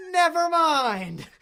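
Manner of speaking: high, loud, drawn-out mock wail; panicked cry. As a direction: cry it out slowly as a hammed-up terrified retreat. Bikers fleeing from the flamethrower.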